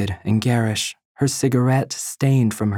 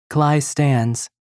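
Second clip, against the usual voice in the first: ordinary delivery, nothing marked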